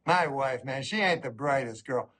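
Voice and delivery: ordinary talk, nothing marked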